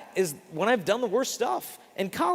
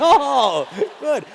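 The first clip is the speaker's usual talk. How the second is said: relieved; 7 semitones higher, 9 dB louder than usual. Retold or imitated. Relieved reaction to the penance.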